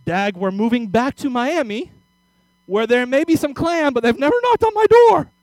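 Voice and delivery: building to a high shout. Retold or imitated. Mom shouting at dad.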